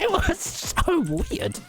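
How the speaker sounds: high, drawn-out squeak of disbelief; incredulous squeal. Real, not acted. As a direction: squeal it slowly, half laughing. Laughing disbelief at the encounter.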